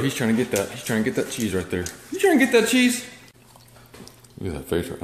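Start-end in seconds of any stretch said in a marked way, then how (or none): 2.1-3.0 much higher coaxing to the dog; high pet voice. Real, not acted. Baby talk to the dog.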